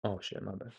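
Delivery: very soft muttered aside; hushed apology. Real, not acted. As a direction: apologize under your breath. Quick aside apology for mishap.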